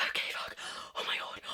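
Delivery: whispered, breathless alarm; acted panic. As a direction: whisper as panic takes hold. Acts out panic in class.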